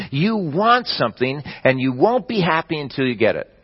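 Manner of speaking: ordinary talk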